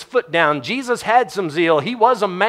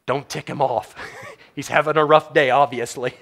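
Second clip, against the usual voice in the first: ordinary delivery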